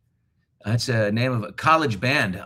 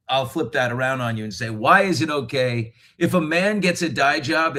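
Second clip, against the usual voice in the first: usual speaking voice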